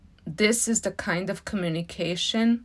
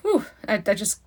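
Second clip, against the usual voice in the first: moved, breathless gush; getting emotional; 4 semitones higher, 5 dB louder than usual. Real, not acted. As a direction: overcome with real feeling, bursting out. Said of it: "I'm just getting emotional".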